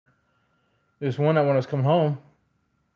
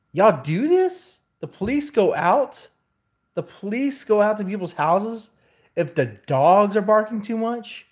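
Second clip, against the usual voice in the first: incredulous; 7 semitones higher, 5 dB louder than usual. Retold or imitated. Disbelief at police over barking.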